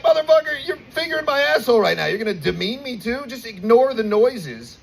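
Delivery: high, exasperated outburst at the doctor; outraged retort. Retold or imitated. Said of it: "And I'm like".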